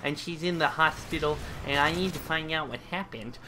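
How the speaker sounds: a hint of a plaintive movie heroine; voiced movie daughter. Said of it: "she's all like".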